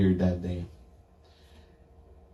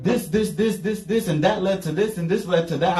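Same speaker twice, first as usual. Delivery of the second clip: a hint of excited rambling, pitched high; mild mock rambling of sudden insight; retold rambling; 8 semitones higher than usual. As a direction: rattle off connections in a rush. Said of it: "I started just rambling".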